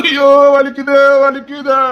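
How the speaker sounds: slow, exaggerated pain moan; hammed-up moaning. Man moans in exaggerated pain at colleague. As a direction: overplay the hurt.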